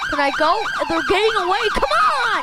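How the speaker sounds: loud, high frustrated yelling. Real, not acted. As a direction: shout at the traffic to move. Yelling at traffic while chasing.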